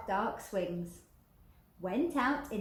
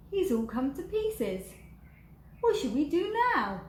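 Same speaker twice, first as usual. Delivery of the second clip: higher and louder fretful voice; worried big skeleton; 7 semitones higher, 5 dB louder than usual. Acted. Big skeleton worried about the scattered dog, higher. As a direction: fret over the broken dog.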